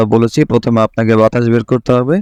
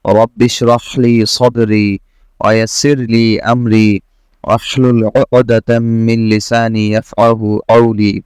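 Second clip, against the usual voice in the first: slow, measured Arabic dua recitation; Quran recitation; much slower than usual. Acted. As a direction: recite the prayer slowly with drawn-out vowels. (ধীর লয়ে দোয়া তিলাওয়াত)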